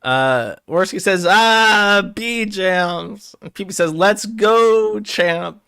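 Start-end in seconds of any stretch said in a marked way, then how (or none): 1.3-3.4 high, loud "ah, bee jams"; mock yell. Reading AI script, voicing Worski's loud goofy outburst. 3.9-5.6 high, loud "let's go"; mock cheer. Voicing a chatter cheering on the streamer.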